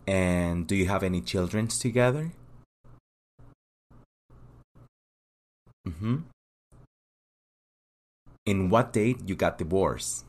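calm, businesslike interviewer running through the questions, pausing for answers; immigration officer. Acted.

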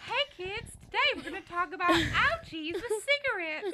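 put-on high, bright children's TV host; chirpy puppet-show host voice; kids' show host voice.

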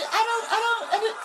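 very high squeaky woman voice; high falsetto what; confused girlfriend. Retold or imitated. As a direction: play her baffled.

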